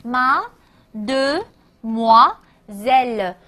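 very slow, separated mademoiselle; syllable by syllable. Shown as a demonstration. Teacher sounding out a longer word for learners, slow syllable-by-syllable. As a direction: break mademoiselle into ma, de, moi, zelle with pauses. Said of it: "那稍微的长一些".

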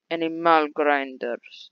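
slow, playful drawl on the words; drawn-out repeat. Pretend. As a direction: stretch out each syllable slowly.

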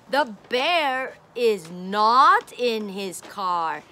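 "not" drawn out and falling; stress on not. Shown as a demonstration. Teacher showing the bear is not in the car, stressing "not". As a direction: lean on the word "not" slowly.

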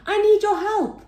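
high, loud plea to friends; calling out. Acted. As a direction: call urgently for help. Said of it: "he called".

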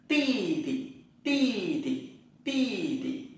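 ti tị said high, crisp and repeated; Chinese tone drill. Shown as a demonstration. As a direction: drill ti tị for the class. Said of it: "Chữ giờ đọc thành chữ tờ nhé".

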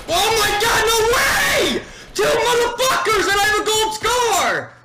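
screaming in frustration; full-on gamer rage yell; raging gamer. Real, not acted. Dying with gold scar, shouting.

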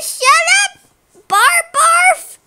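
high, loud mock-angry outburst; acted rude retort. Penguin snapping back in a quarrel, mock angry.

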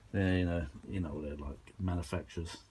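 ordinary talk, nothing marked

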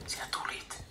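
a weak father's hoarse murmur. A narrator's reading. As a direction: croak it out in relief. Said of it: "isä sanoo käheästi".

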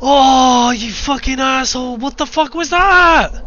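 loud, high frightened shouting; panicked yelling. Real, not acted. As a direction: yell in real panic after a jump scare. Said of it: "Sorry if I was too loud there".

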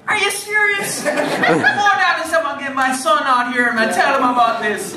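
put-on excited, laughing Jamaican accent; high, delighted fan in a Jamaican accent. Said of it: "there's a horrible Jamaican accent".

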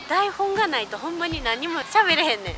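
usual speaking voice

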